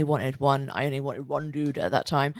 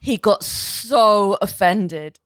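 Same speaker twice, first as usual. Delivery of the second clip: slow emphatic; 2 semitones higher, 7 dB louder, much slower than usual. Real, not acted. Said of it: "Oh, so badly".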